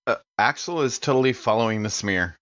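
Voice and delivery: usual speaking voice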